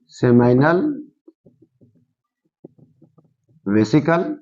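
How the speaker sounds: Seminal and vesicle far apart, each rising; seminal, long pause, vesicle, for note-takers; slow dictation. Shown as a demonstration. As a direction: dictate seminal vesicle one word at a time. Teacher dictates term with long pauses.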